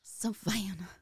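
put-on echoing a drawled Southern accent. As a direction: copy the Southern Savannah drawl back. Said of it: "What? Why?".

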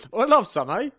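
high, loud comic British; British accent pushed up high; mock British accent. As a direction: parody a British villain. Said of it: "is Vader fucking British".

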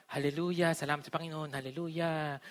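teasing churchy sigh of praise; lightly hammed-up devout muttering; mock pious murmur. Imitates acquaintance who always says hallelujah.

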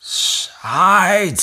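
high, loud drawn-out exclamation; excited cheer. Acted. Savi hearing dad's curry promise, cheering. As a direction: burst out in delight. Boy hears of new curry supplies.

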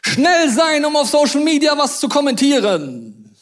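high, loud jeer on "Schnell sein"; loud sarcastic "Schnell sein"; mocking shout. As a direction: throw "Schnell sein" out like a taunt. Preacher repeats word mockingly.

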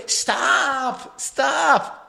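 high, drawn-out plea; pleading girlfriend. Acted. Girlfriend trying to halt a fight, high plea. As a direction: imitate a girlfriend begging, high and stretched.

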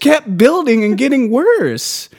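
slow, high and heated; rising indignation; building anger. Real, not acted. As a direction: let the frustration spill out. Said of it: "It's because I'm getting so angry".

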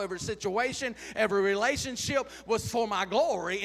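loud, building, half-sung preacher's climax; preaching at full force. Acted. Preacher telling the church it was for God's glory, sung-out loud preaching.

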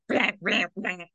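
quacky cartoon duck voice; Donald Duck voice. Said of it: "That's my Donald Duck impression".

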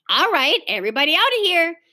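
a touch of bossy command, fast; ordering. A narrator's reading. Big sister ordering the party children out of the room. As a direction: hurry the children out. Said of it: "Beezus ordered".

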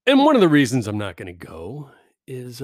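normal talking voice

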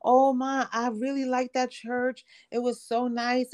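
high, bright-eyed imitation of an excited boy; excited son. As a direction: gush like a kid back from church. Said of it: "he came back extremely excited".

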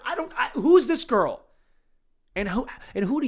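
high, loud disbelieving question; incredulous high squeak. Real, not acted. Exasperated reaction.

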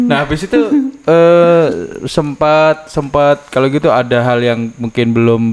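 usual speaking voice

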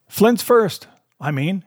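high cry, dropping on the last words. A narrator's reading. Blind man corrects man at window, crying out. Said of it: "he cried".

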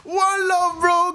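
high, loud, near-crying joy; ecstatic echo. Real, not acted. Host echoes the guest's Nigeria chant, ecstatic cry. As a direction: shout it out overcome.

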